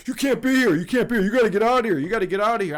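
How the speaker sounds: urgent, repeated shouted commands; hectoring airport guard. Retold or imitated. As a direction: imitate a pushy guard shouting people away. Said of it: "immediately the dude's on me. He's like".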